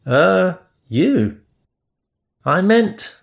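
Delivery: lightly voiced awkward Englishman; flustered reply. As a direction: caught out and embarrassed.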